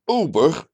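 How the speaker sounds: a jovial, emphatic call of "Ober"; jovial emphasis. A narrator's reading. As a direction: summon the waiter with cheeky emphasis. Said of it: "met een olijke nadruk".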